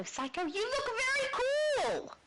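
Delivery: ordinary delivery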